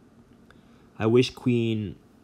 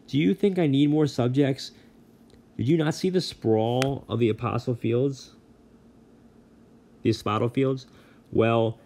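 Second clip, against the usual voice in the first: a lightly voiced, louder god of the dead complaining; exasperated Hades; 4 semitones higher, 4 dB louder than usual. A narrator's reading. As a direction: rant like an overworked king.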